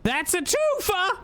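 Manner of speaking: high, stretched-out cry of delight; delighted exclamation. Real, not acted. Player celebrating a twofer, high excited cry. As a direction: crow it up high, drawing it out.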